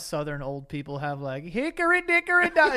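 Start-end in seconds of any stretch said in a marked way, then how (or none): 1.4-2.7 put-on squeaky high old-timer voice, much higher; high mock old-folks singsong; mock old Southerner. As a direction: lampoon a Southern granny. Imitating Southern old people.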